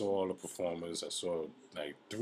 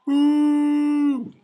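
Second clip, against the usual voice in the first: long, high, loud booing; imitated crowd boo; 18 dB louder than usual. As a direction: boo like a whole jeering audience. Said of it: "they was booing me".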